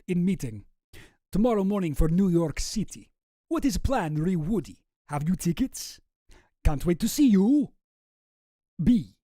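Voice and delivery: put-on hammy amateur Russian accent; Boris with Russian accent. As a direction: practise a thick mock-Russian voice. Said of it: "trying to practice the voice".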